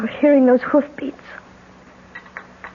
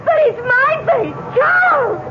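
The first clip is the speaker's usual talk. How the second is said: hysterical terror; 12 semitones higher than usual. Acted. Wife recognising her own face on the ghost, hysterical crying shout. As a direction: break into a sobbing shout for help.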